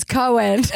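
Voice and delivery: "Cohen" for coin, in two syllables; mispronounced coin. Retold or imitated. Mocking how mom says coin, pronounced "Cohen" in two syllables. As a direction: say "Cohen" as mom's botched coin. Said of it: "She can't say the word coin".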